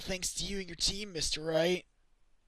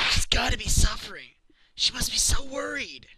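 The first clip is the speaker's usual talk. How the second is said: higher, distressed outburst; anguished worry; 6 semitones higher, 5 dB louder than usual. Acted. Client fearing for the jailed girl, anguished. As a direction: voice rising with worry for her.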